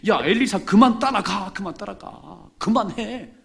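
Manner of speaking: loud, high mocking yell; taunting shout. Students jeering at Elisha to stop following, loud and high. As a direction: shout him down, quit following.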